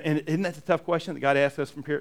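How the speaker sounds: ordinary talk, nothing marked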